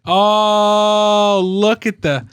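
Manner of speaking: high, loud mock exclamation; loud mock exclaim. Husband mocking wife's exclaim, loudly. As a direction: belt it out mockingly. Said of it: "And it's like".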